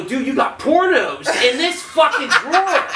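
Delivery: high, exasperated disbelief with laughter; retold incredulous confrontation. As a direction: demand an answer, half laughing. Retells confronting his bunkmate.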